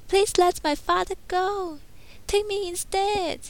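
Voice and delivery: put-on high, hesitant young woman's voice; young heroine. A narrator's reading. Belle turning down Gaston's proposal, high hesitant heroine voice. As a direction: lift the voice and stumble politely.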